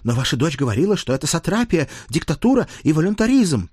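high, rising list of accusations; indignant page. Acted. Page cites daughter's accusations to the lord, indignantly.

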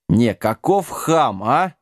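mildly indignant, drawn out. A narrator's reading. Wizard complains about the rude giant, indignant. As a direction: slowly voice your outrage. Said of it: "возмутился колдун".

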